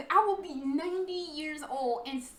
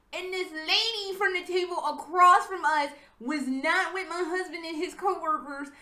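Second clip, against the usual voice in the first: ordinary talk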